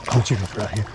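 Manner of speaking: ordinary talk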